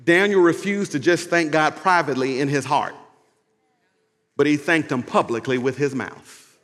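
normal talking voice

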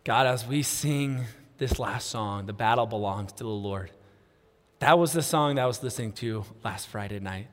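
low, soft spoken prayer; closing prayer. Real, not acted. Preacher praying before the last worship song, closing prayer. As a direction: pray it quietly and low, with long pauses.

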